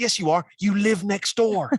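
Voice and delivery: retold exasperated neighbour, high and loud; incredulous retort. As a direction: act out insisting on the obvious. Homeowners contradict the thief, retold.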